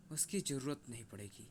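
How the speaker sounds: low, softened answer; restrained reply. Acted. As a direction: answer the threat under control, without raising the voice. Chief coolly answers wolf-god's warning. (दबी, नीची आवाज़ में)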